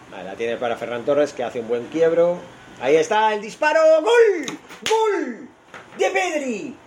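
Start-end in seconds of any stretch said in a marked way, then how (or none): 2.8-6.8 rising into a huge goal yell; goal scream. Real, not acted. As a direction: scream the goal at the top of your voice. Goal scored, commentator erupts.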